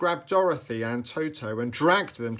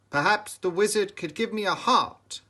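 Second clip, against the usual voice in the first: high, louder hopeful tin voice; hopeful Tin Man; 9 semitones higher, 6 dB louder than usual. A narrator's reading. Tin Man telling Dorothy he wants a heart, hopeful and pitched up. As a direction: wish for a heart out loud. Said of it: "said the Tin Man hopefully".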